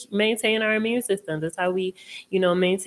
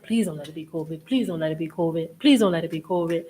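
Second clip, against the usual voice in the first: rushed prayer; 3 semitones lower, much faster than usual. Retold or imitated. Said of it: "I was praying".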